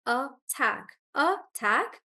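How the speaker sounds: attack split, with TACK stressed. Shown as a demonstration. Teacher answering a question, attack stressed on TACK. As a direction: separate the syllables of attack, punching TACK. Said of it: "It's on the second syllable".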